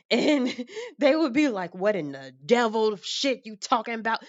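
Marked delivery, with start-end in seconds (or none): 1.7-4.2 a touch of an outraged relative; mildly hammed-up indignant elder; upset family. Family scolding her belief in nature, lightly outraged voice. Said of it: "they would get so upset".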